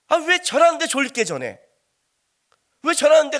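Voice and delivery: high, loud mock grumble from the pews; exasperated complaint. Churchgoer complaining about sleepy preaching, exasperated. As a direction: protest like a fed-up listener.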